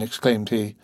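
ordinary delivery

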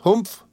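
high, questioning hmph; a sly questioning grunt; questioning humph. Acted. Mowcher hums a question at both of them. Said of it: "first exclamation sounded like a question".

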